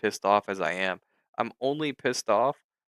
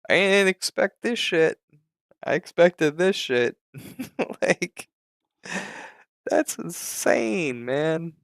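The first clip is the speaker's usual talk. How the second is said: higher and louder, half laughing; worked-up laughing rant; 7 semitones higher, 4 dB louder than usual. Real, not acted. Son ranting about his dad's squeezed short, higher and louder, half laughing.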